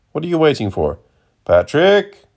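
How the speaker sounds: high, impatient call to a servant; high-pitched summons, climbing at the end; crying out. A narrator's reading. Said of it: "cried the man".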